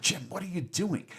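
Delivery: freaking out, mildly acted; Bones. Bones objecting to Kirk questioning God, mock freak-out. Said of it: "And Bones starts freaking out".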